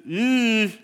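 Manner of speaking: drawn-out wince of secondhand embarrassment; cringing "eesh". Retold or imitated. Imitating the guests' awkward cringe.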